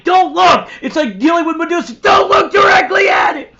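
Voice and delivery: high, loud mock-frantic warning; hammed-up shouted alarm; panicked shout. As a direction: yell like Medusa is in the room. Friends shouting warnings, laughing.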